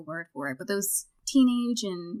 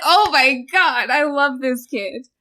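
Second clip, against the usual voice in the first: loud, drawn-out, higher-pitched rave; thrilled gushing; 5 semitones higher, 10 dB louder, slower than usual. Retold or imitated. Host retelling her reaction to a favourite character, thrilled gushing. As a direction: gush it slowly and loudly, delighted.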